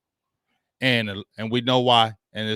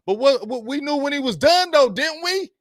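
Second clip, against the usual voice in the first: high, louder insistent push; heated point; 12 semitones higher, 5 dB louder than usual. Real, not acted. Host arguing about a player's Hall of Fame case, heated. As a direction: press the point hard.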